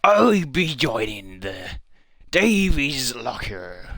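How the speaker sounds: slow, stretched-out pirate drawl; pirate accent. Acted. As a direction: drag the words out like a pirate. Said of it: "uh, pirate accent".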